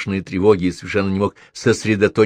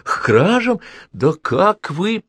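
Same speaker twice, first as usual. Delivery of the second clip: outraged; 9 semitones higher, much slower than usual. A narrator's reading. Professor outraged at accusation.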